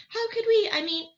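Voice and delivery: put-on overexcited child talking over others; excited. Boy bursting with questions, high and loud.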